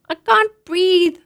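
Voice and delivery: put-on high, slow, strained child's voice; a small girl squashed in a drum; gasping little girl. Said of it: "I said".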